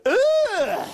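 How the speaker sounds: very high, slow, hammed-up shriek of yuck; exaggerated squeamish surprise, laughing; mock disgust. Magician pulls a worm from the bag for Paul, mock disgusted shriek.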